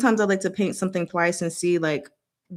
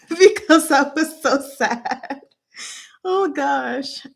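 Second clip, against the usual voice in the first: higher voice breaking into laughter; embarrassed laughing; 7 semitones higher, slower than usual. Real, not acted. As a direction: laugh while admitting sadness. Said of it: "sometimes people laugh when they're embarrassed".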